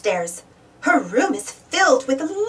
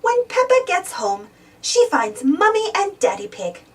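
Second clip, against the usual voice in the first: ordinary delivery, nothing marked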